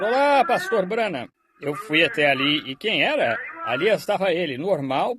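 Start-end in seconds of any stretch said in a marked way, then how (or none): 0.0-1.3 high-pitched hail, no real shout; mock call, pitched well up; retold calling out. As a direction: imitate someone calling a greeting from afar. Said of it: "ouvi alguém gritar".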